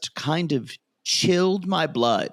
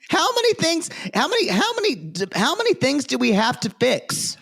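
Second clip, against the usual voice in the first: exasperated; 9 semitones higher than usual. Real, not acted. Exasperated mock complaint.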